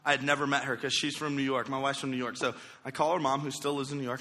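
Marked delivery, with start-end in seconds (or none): none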